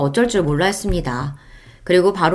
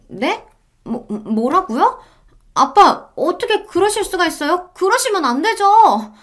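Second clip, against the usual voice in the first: higher, pleading disbelief; stunned then begging dad; shocked daughter; 11 semitones higher, slower than usual. Acted. Daughter hears disinheritance. (충격받아 애원하는 딸)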